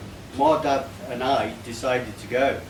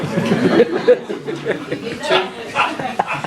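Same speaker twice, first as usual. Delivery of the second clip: loud, high laughing fit; burst of laughter; 9 semitones higher, 4 dB louder than usual. Real, not acted. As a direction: laugh out hard. Laughing at his own roast.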